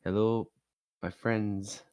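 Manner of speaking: usual speaking voice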